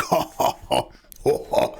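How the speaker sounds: breathy villain cackle; evil laugh. Pretend. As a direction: cackle like a dark lord. Villain laugh before foolish mortal.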